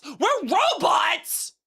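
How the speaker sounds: stunned high-pitched question; disbelieving slow shout; surprised, high, slow. Real, not acted. Surprised repeat.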